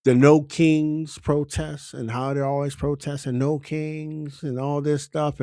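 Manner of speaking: ordinary talk, nothing marked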